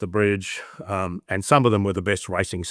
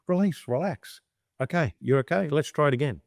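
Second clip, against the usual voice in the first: calming the horse; 6 semitones higher than usual. Retold or imitated.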